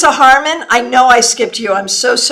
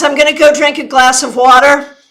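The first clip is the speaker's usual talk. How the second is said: ordinary talk, nothing marked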